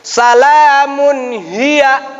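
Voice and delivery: "hiya" with a big pressed throat ha, very slow; heavy ha. Shown as a demonstration. Teacher stresses the first ha in Al-Qadr, slow chant. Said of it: "Haknya yang pertama ha besar".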